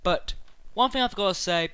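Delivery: ordinary talk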